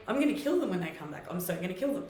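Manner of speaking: normal talking voice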